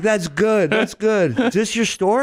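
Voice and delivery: put-on Israeli-accented customer voice. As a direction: imitate an Israeli customer angling for a discount. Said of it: "He's like".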